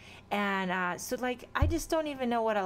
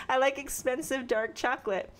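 laughing admission; 3 semitones higher than usual. Real, not acted.

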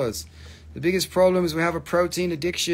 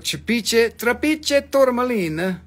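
playful sales pitch in a higher put-on voice; put-on seller voice; 9 semitones higher, 4 dB louder than usual. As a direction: ham up a pushy gem saleswoman.

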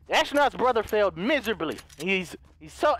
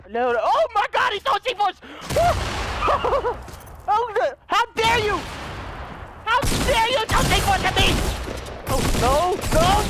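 panicked shout; 10 semitones higher, 3 dB louder than usual. Real, not acted. Enemy throws C4 at him.

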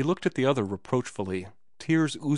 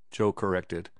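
usual speaking voice

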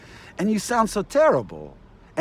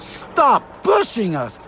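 higher, slow, loud demand to back off; angry shout; 6 semitones higher, 3 dB louder, slower than usual. Real, not acted. Ranting at the gas chain, slow angry shout. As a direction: yell it slowly as a demand.

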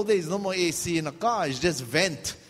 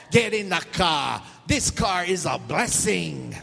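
insistent mom; 3 dB louder, slower than usual. Retold or imitated. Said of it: "my mom was like".

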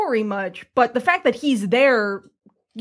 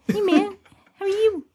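high, relaxed New Zealand Korg voice; cheery New Zealand rock monster; New Zealand accent; 10 semitones higher than usual. Retold or imitated. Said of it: "uses his New Zealand accent".

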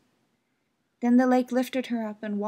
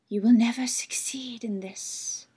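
airy whispered lake spirit; 5 dB louder than usual. Acted. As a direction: breathe it like still water. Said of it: "said the lake".

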